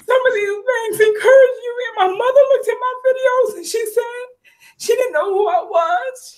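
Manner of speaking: fighting through sobs to get the words out; tearful, choked-up words about her mother; crying while talking. Real, not acted. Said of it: "I don't wanna cry".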